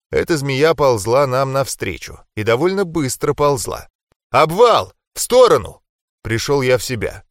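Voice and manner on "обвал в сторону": high, loud alarm shout; shouted warning. Acted. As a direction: yell the warning. Warning of rockslide.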